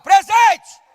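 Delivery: high, loud bellowed answer; boy yelling his answer to the teacher; shouted roll call. Retold or imitated. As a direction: blast the word like at home.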